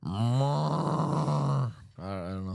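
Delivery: very slow, drawn-out bison bellow; Colorado Buffalo. Acted. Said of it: "my Colorado Buffalo impression".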